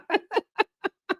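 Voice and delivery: a high amused laugh at his own rant. Real, not acted.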